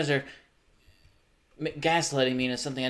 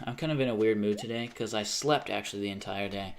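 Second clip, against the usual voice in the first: normal talking voice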